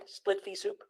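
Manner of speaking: unvoiced, hushed repeat of the pun; whispered punchline aside. Shown as a demonstration. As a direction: breathe the pun again under your breath. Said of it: "Get it?".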